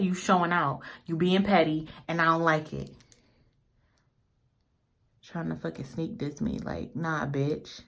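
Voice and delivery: usual speaking voice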